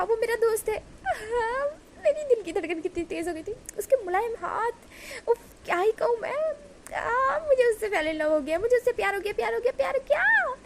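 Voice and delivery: high, swooning lovesick squeal; lovestruck gushing. Acted. Smitten young man raving to his friends, high and gushing. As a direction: gush over him like a boy in love for the first time. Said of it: "इतनी ज़ोर-ज़ोर से चिल्ला चिल्ला के कह रहा है".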